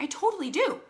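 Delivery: high, emphatic retort. Retold or imitated. Telling husband Kenny about the pokey hairs, high insistent retort. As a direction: insist brightly, voice jumping up. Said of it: "I'm like".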